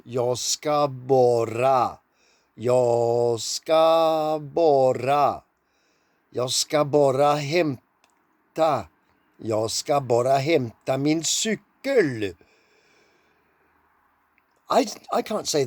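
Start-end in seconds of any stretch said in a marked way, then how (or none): 0.0-5.4 Jag ska bara dragged out, ska stretched and louder; exaggeratedly slowed Swedish demo. Shown as a demonstration. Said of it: "slow down". 6.3-12.2 Jag ska bara hämta min cykel said slowly, cykel falling at the end; patient teacher slowing the Swedish down; slowed Swedish. Shown as a demonstration. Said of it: "slow down".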